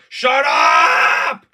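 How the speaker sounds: long, high, loud yell; furious shout. Acted. Fed up with the other character's singing, furious shout. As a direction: explode to cut him off.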